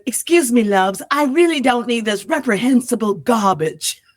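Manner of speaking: hammed-up English accent for an audition; proper English take on a crude line. Actress doing a second audition take, in an English accent. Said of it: "Can I do a second take in an English accent?".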